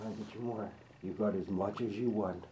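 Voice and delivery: ordinary delivery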